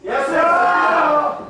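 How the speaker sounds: very slow stretched shout; long shout. Shown as a demonstration. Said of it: "시작!". (길게 끌며 크게 외치기)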